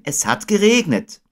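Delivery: put-on high child character voice; Christopher Robin. A narrator's reading. Boy agreeing with Owl, high and straightforward. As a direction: echo it high, at an everyday pace. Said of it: "sagte Christopher Robin".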